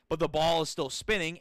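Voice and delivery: ordinary talk, nothing marked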